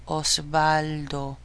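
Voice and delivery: "Osvaldo" slowly, drawn out syllable by syllable; sounded-out demo. Shown as a demonstration. Teacher helping learners hear the middle letter, "Osvaldo" drawn out slowly. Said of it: "Can you hear the W".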